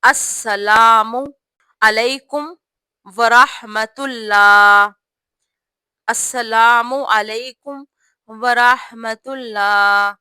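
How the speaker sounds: very slow, السلام علیکم ورحمۃ اللہ with pauses between words; slow word by word salaam. Shown as a demonstration. Teacher dictating the salaam word by word. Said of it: "آپ نے ایسے پڑھنا ہے".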